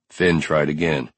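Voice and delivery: ordinary delivery, nothing marked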